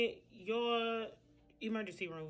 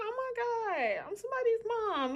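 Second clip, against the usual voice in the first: delighted squeal. Retold or imitated. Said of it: "I was like".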